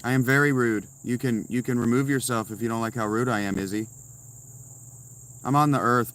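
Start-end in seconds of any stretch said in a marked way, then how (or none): none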